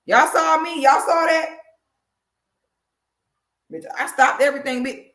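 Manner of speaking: loud, high, worked-up exclaiming; adrenaline rush. Real, not acted. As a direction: shout it with blood pumping. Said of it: "My blood had just started".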